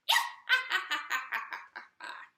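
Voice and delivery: high, loud demo cackle; wicked witch cackle demo. Shown as a demonstration. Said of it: "sounds a bit like this".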